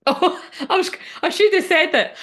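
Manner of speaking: high, laughing giggle through the words; real giggling surprise. Real, not acted. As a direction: laugh it out, delighted. Laughing at the devil card.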